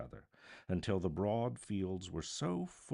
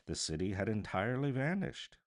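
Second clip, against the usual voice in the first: ordinary delivery, nothing marked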